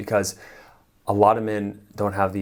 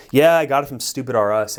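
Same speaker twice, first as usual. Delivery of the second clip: higher, louder teasing reply; playful comeback; 5 semitones higher, 8 dB louder than usual. Shown as a demonstration. Coach answering girlfriend's jab, teasing. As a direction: toss the joke back cheekily. Joking back at her jab.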